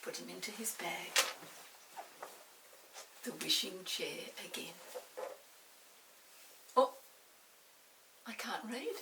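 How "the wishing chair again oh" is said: ordinary delivery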